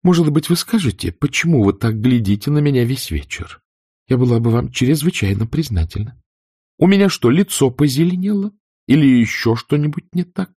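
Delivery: high, sugary mock politeness building to a jab; sarcastic. A narrator's reading. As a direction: needle India, rising with each question. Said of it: "язвительным тоном".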